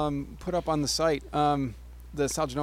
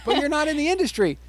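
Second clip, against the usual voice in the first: mock retort; 9 semitones higher, 3 dB louder than usual. Mocking retort, high pitch.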